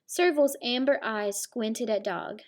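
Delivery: ordinary delivery, nothing marked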